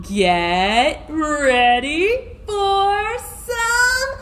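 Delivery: very slow words climbing to a burst; drawn-out build-up. Real, not acted. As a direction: stretch it out, then release on FUN. Repeats finger-wiggle build-up for children.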